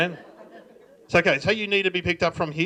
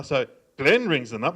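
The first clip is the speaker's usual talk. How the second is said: ordinary delivery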